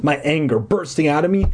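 usual speaking voice

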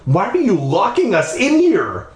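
high-pitched, indignant demand. Acted. Stephanie to her captor, indignant demand. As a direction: demand an answer, voice pitched right up. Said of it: "Stephanie demanded".

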